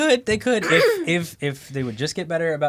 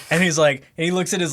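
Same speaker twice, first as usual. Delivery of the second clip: ordinary talk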